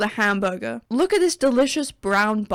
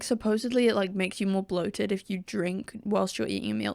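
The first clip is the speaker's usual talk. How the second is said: ordinary talk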